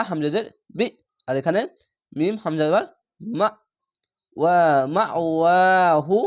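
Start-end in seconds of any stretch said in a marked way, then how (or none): 4.4-6.2 "ওয়া মা ওয়াহু", push on মা, long second ওয়া; jolting emphasis. Shown as a demonstration. Teacher recalls the earlier example for learners, jolted then stretched. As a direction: jolt মা, then draw the ওয়া out slowly. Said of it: "এরকমভাবে পড়েছিলাম ঝোঁক মেরে".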